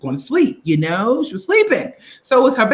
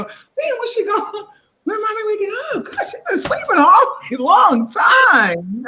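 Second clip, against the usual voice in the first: put-on much higher whiny child voice, laughing through it; impatient little kid; 14 semitones higher than usual. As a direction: whine like a kid tired of waiting. Child waiting for mommy to wake.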